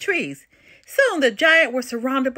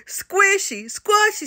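little giant; 9 semitones higher, slower than usual. A narrator's reading. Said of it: "said the little giant".

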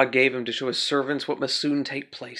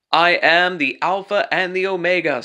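higher and louder, proclaiming; voice of God; 4 semitones higher, 7 dB louder than usual. Acted. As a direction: announce it with divine authority. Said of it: "says the Lord God".

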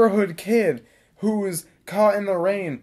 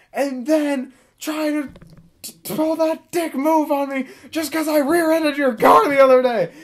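angry rant; 10 semitones higher than usual. Retold or imitated. Driver ranting to passenger about the dealer, furious. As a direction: rant like she's been cheated.

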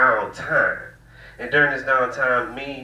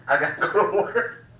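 high laughing giggle through the words; 9 semitones higher than usual. Real, not acted. Comic laughing at his own joke, high giggle. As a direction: crack up mid-line.

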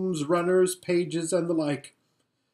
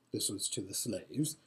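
ordinary delivery, nothing marked

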